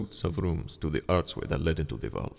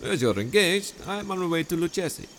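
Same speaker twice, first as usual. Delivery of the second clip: higher, sweetly scheming host voice; honeyed charm pitched up high; Montresor, falsely friendly; 8 semitones higher than usual. Acted. Montresor dialogue.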